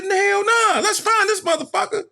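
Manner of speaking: very high, indignant mock shout; retold outrage. Setting off to find the promoter, indignant. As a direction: refuse it, all fired up.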